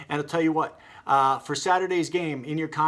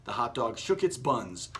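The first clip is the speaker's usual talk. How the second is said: ordinary delivery, nothing marked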